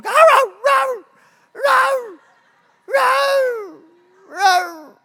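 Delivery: very high, loud mock howl of a frantic dog. Preacher mimics the frantic dog at the food, high howling. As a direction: throw your voice up and howl it out like a dog. Imitating the dog's howling bark.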